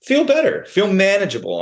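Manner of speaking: high, mocking sing-song imitation; mimicking the brother's advice scornfully; mock mimicry. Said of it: "And I'm like".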